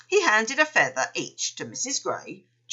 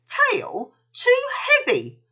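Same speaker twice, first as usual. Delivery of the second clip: high, slow astonished exclamation; exclaiming; 4 dB louder, slower than usual. A narrator's reading. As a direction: gasp it out in disbelief. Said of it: "she exclaimed".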